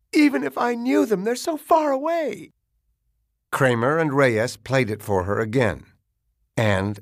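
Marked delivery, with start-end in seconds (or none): 0.1-2.5 high-pitched, distressed whimper. A narrator's reading. Mother unable to recognize the abductors on tape, whimpering. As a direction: wail it weakly in a much higher voice. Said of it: "she whimpered".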